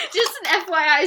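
ordinary delivery

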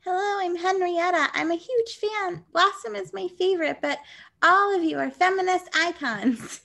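put-on high, gushing voice of a cute little girl; high little-girl fan. Cute little girl introducing herself to her heroes, high and gushing. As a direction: play a small child meeting her idols.

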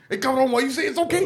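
fast, high, laughing shout; mock telling-off. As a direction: shout the scolding through laughter. Joking telling-off of store guy.

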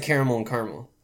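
"caramel" as CARE-a-mel, then "caramel" as CAR-mel; three syllables in first "caramel", two in second; two pronunciations. Shown as a demonstration. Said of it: "for me it's like".

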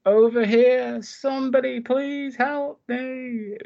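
very high, slow, eerie plea from far off; the killer's mother luring the girl, eerie high calling voice; creepy distant voice. Retold or imitated. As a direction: lure her out into the rain. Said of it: "you hear the voice again".

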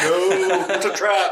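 high, loud, laughing outcry; mock alarmed warning. Viewer warning a doomed character, laughing mock alarm. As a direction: cry out a warning to the screen, half laughing.

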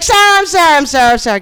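frantic retold shout to mom to hand over the phone; urgent calling out. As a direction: grab her attention fast to get the phone back. Urgently calling to her mother for the phone.

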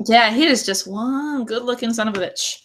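normal talking voice

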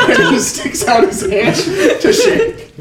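high, laughing through the words. Real, not acted. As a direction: crack up over your own fumble. Cracks up over fumbled name.

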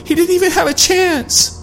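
high, tearful voice; distraught. A narrator's reading. Allie recounting the escape, tearful. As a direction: force the words out shaking.

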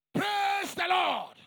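loud, high, drawn-out praise cry; imitated scream. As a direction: cry it out slowly like a worshipper. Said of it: "she would scream".

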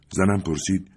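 usual speaking voice

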